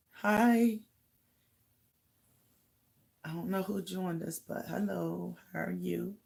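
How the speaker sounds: ordinary delivery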